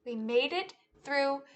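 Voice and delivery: lively, sing-song storytime read; animated picture-book telling; reading to children. Acted. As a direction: act out the story for a class of kids. Teacher retells picture book with moves.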